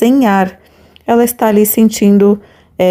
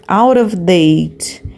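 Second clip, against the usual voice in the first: slow model pronunciation; 2 semitones lower, much slower than usual. Shown as a demonstration. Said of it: "teacher models English phrase".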